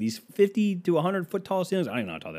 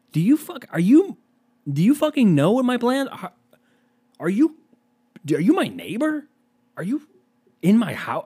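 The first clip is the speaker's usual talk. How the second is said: high, stammering, incredulous outburst; mock-outraged interrogation, pitched up; exasperated disbelief; 3 semitones higher, 4 dB louder than usual. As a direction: demand answers, breaking off and restarting. Acted outburst at nosy stranger.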